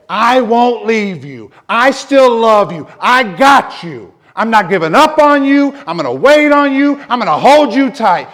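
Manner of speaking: high, loud, tender promise of God. Pretend. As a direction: lift it up like God holding you. God's voice voiced in sermon.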